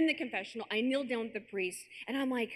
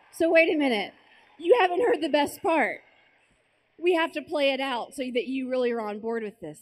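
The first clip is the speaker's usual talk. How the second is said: excited loud; 3 semitones higher, 10 dB louder than usual. Real, not acted. Urging the audience to hear the best part, loud and excited. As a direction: raise your voice, too excited to let them stop you. Building excitement about joke idea.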